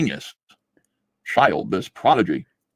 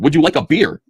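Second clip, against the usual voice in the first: hammed-up recruiter voice; mock recruiter offer; 6 semitones higher, 6 dB louder than usual. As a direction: dangle the beer like a bait. Repeats mocking recruiter offer.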